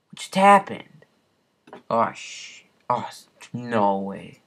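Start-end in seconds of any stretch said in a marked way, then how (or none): none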